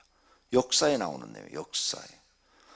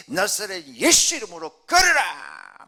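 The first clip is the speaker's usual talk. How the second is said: loud, high command with a drawn-out last word; Peter's forceful command; 7 semitones higher, 12 dB louder, slower than usual. Acted. Peter commanding the lame man to walk, forceful shout. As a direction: shout it as a bold, hasty healer. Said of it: "베드로가 성품이 좀 급하잖아요. 강하고 급해요".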